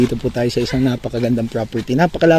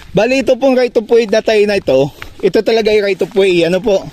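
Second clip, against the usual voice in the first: high, raised questioning voice; calling out; 10 semitones higher, 4 dB louder than usual. Real, not acted. Vlogger confirming right of way with landowner, calling out high.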